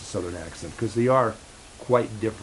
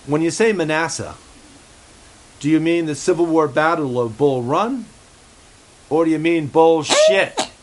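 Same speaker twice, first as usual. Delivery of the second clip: a lightly put-on voice, rising louder towards the punchline; Basil's voice; 4 semitones higher, 5 dB louder than usual. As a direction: play the cheeky puppet as he needles the guest. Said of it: "Basil asks you".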